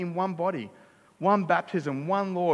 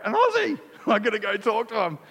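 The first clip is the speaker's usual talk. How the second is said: high, loud delighted cry, hammed-up; excited recognition; 6 semitones higher, 5 dB louder than usual. Homesick Australian overhears another abroad, excited. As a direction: burst out like spotting a countryman. Overseas, acting out spotting fellow Australian.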